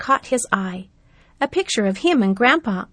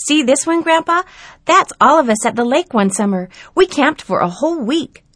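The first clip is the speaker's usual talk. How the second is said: put-on high, loud boy's voice, proudly showing; a boy pointing out a lake holiday photo; eager young boy; 6 semitones higher, 7 dB louder than usual. Grandson shows photos.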